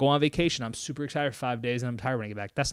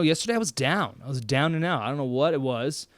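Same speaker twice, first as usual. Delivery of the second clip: usual speaking voice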